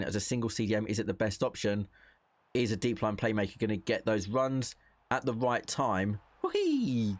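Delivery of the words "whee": high delighted whee; sudden high-pitched whoop; gleeful squeal. Real, not acted. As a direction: squeal with joy at the goal. Celebrating a goal in game.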